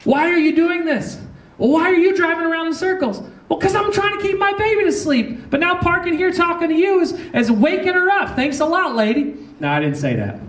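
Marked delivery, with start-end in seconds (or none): 0.0-3.2 high-pitched, exasperated demanding; worked-up retold quarrel voice; acted angry confrontation. Acted confrontation at the car window. 3.5-9.4 very high, exasperated comeback; hammed-up aggrieved parent telling someone off; acted indignant retort.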